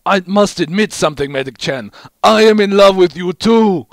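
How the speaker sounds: loud, high passionate declaration; hammed-up confession. A narrator's reading. Heavy confessing love to Medic, loud high ham.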